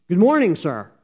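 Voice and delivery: a guard snapping to attention; soldierly good morning; crisp salute. Acted.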